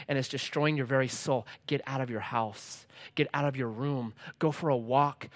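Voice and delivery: soft, low, earnest counsel; gentle urging. Acted. Preacher speaking to depressed people stuck indoors, soft earnest urging. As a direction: quietly coax them out, keeping it low.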